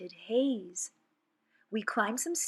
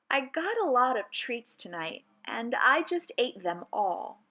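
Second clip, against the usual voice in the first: plain reading voice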